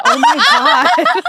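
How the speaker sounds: very high, drawn-out squeal; hammed-up dismay through a laugh; mock exasperation. Acts exasperated reply to friend.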